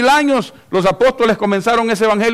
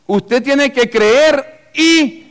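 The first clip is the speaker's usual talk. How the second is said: raised, slow, drawn-out insistence; insistent demand; 8 semitones higher, 4 dB louder, much slower than usual. Retold or imitated. Preacher voicing what sects tell believers, insistent. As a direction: mimic a demand, pitched up and stretched.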